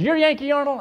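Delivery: high, loud hostile challenge; mean and ugly. Retold or imitated. Irate father checking who he has reached, mean and ugly. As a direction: imitate an irate caller confronting someone. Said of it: "Real mean and ugly".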